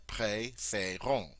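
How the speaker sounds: préférons slowly, with the long A in the second syllable, like fay; slow model pronunciation. Shown as a demonstration. Teacher modeling the easy long A in préférons. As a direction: lay out préférons slowly and cleanly. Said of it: "it's very easy to say that, that long A sound there".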